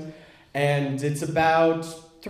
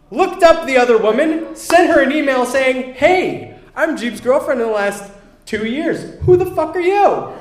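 agitated retelling; 9 semitones higher, 6 dB louder than usual. Retold or imitated. Friend relays the angry email excitedly.

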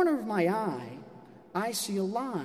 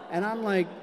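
ordinary talk

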